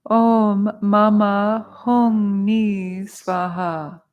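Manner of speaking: very slow, sustained intoning; mantra recitation. Shown as a demonstration. Teacher leading students who repeat after her, slow chant. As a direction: stretch every syllable for others to follow. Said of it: "Repeat after me".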